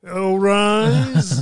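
loud, high booming "All rise"; court usher call. Retold or imitated. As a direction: bellow it like a pompous court usher.

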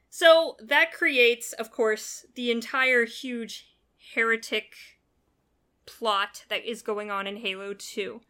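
ordinary talk, nothing marked

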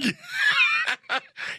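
high howl of laughter; shrieking laugh. Real, not acted. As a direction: burst into a squealing laugh. Burst laughing at Florida joke.

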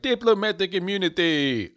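high, slow South African movie villain; big hammed-up South African line reading; South African accent. Shown as a demonstration. Showing his Die Hard route into the South African accent. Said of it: "South African's one of them accents".

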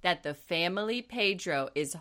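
slow, drawn-out imitation of their speech; mock slow talk. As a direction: spell it out syllable by syllable. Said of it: "They all talk very slowly".

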